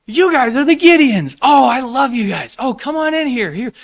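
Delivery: very high, loud, delighted welcome; overjoyed hotel clerk. Retold or imitated. Hotel clerk welcoming the Gideons, very high and loud with joy. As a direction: gush like a clerk thrilled to see them.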